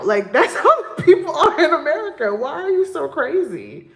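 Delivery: loud, high, disbelieving complaint; indignant loud rant with laughs; exasperated outburst. Real, not acted. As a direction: vent it, voice climbing and loud.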